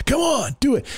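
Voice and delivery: high-pitched mock egging-on; goading. Demon voices pushing him to act, goading. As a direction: imitate a pushy voice urging someone on.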